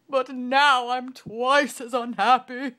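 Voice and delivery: very loud, high, slow wail; king wails his doubled misery. Acted. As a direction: howl it through tears. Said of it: "he wailed".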